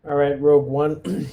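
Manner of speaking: ordinary talk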